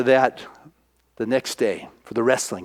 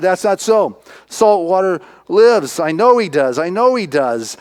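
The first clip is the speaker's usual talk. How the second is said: louder, emphatic acted protest; insistent protest; 5 semitones higher, 5 dB louder than usual. Boy protesting that his hero lives, loud insistence. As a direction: insist on it like a believer.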